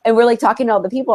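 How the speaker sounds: ordinary talk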